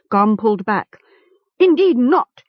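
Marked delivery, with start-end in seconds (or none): 1.6-2.4 high, loud refusal; indignant cry. A narrator's reading. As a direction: cry out in protest. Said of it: "he cried".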